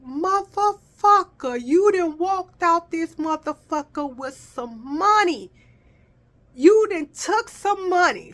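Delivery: high, slow, giddy self-talk; gleeful disbelief. Retold or imitated. Gleeful inner voice.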